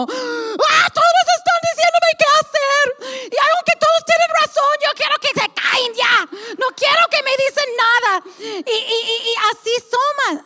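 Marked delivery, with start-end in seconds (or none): 0.0-8.1 frustrated outburst. Retold or imitated. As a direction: burst out fed up with everyone's advice. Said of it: "y yo como".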